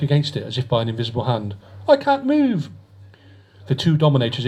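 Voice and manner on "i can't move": high, frightened cry of a trapped man; panicked cry. A narrator's reading. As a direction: cry out in panic, pinned to the wall. Trapped captive cries out.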